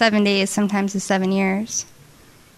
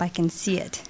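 hushed; 3 semitones lower, 8 dB quieter than usual. Retold or imitated. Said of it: "God is setting this up".

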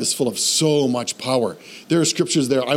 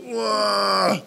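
long, drawn-out groan; wordless groan; 5 semitones higher than usual. Shown as a demonstration. Preacher showing listeners a groaning prayer, long wordless groan. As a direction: groan it out, too deep for words. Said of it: "too deep for words and you go".

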